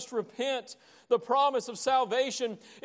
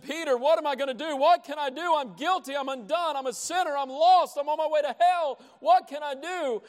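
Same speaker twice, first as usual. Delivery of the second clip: higher and louder, a frantic plea; desperate crying out; 5 semitones higher, 5 dB louder than usual. Acted. Guilty crowd crying out to Peter, desperate. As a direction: beg in panic for an answer. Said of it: "they cried out with such passion".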